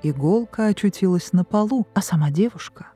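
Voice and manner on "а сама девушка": ordinary delivery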